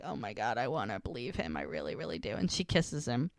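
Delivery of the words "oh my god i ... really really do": low, soft murmured thought; inner longing. Retold or imitated. Woman gazing up at her ex, quietly yearning. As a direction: lower and softer, as if thinking it privately. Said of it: "She's looking up at him like".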